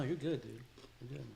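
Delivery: ordinary talk, nothing marked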